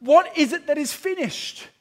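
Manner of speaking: loud, high proclamation; loud ringing cry. Retold or imitated. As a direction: call it out from the cross. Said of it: "he cries out".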